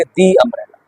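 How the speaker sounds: "the umbrella" with the as "dee"; long ee in "the" before umbrella; correct "the". Shown as a demonstration. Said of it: "वहां पे बोलेंगे".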